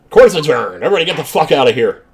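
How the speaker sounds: loud, fast judge's bellow; hammy judge. Acted. Judge dismissing the court, loud fast hammed-up bellow. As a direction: bang the gavel with your voice. Voicing judge ending trial.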